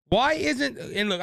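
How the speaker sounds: ordinary talk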